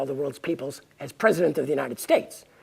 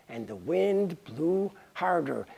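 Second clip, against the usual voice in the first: ordinary delivery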